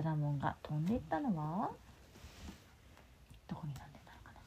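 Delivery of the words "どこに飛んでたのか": unvoiced storybook whisper. Mother whispers a question to her child, hushed. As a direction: breathe the question out secretly.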